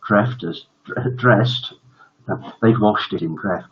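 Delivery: ordinary delivery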